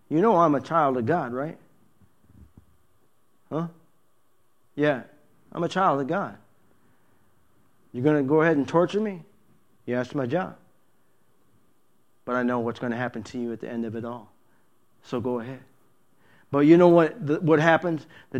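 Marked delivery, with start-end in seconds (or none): 0.1-6.4 softly spoken, matter-of-fact declaration; understated, quiet assurance to a foe; quiet confession. Acted. Believer quietly answers the devil. 7.9-15.6 very soft, low, quick murmur; underplay the exchange almost under the breath; hushed, unruffled acted dialogue; quiet confrontation.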